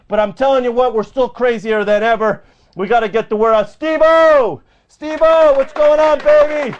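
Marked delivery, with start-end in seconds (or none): 3.7-6.7 higher, rowdy host greeting; hollered shout-out. Real, not acted. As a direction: holler out to a favorite listener.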